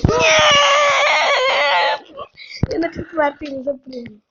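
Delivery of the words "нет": shrill filtered shout; squeaky voice. Real, not acted. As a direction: helium voice yell.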